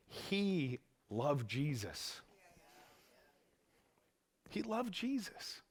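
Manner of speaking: soft, slow tender words; preacher quietly grieving over Peter's fall; hushed. Real, not acted. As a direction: say it softly, almost to yourself. Hushed emotional repetition.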